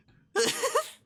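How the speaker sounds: a sudden high burst of laughter; surprised laugh. Real, not acted. Streamer hears the coworker reveal and laughs out loud.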